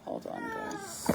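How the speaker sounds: high, slow sing-song to the babies. Real, not acted. Mom talking to her babies, high baby talk.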